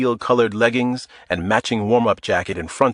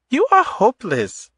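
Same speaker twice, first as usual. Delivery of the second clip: put-on fond, higher voice with a light Eastern European accent; softening, light Eastern European accent; 13 semitones higher than usual. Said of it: "softening and touching his cheek".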